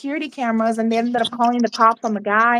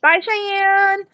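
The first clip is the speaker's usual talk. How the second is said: calling out; 8 semitones higher, slower than usual. Real, not acted. Thanking her partner for lunch, calling out goodbye. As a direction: call out a goodbye to someone leaving.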